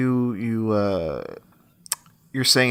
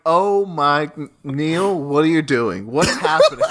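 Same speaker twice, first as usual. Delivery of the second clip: laughing, raised disbelief; mock alarm; 4 semitones higher than usual. Viewer reacting to the fight scene. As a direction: cry out in amused panic. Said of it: "I'm like".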